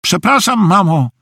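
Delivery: high-pitched called-out apology, little added volume; zawołanie. A narrator's reading. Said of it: "krzyknął". (lekki okrzyk wysokim głosem)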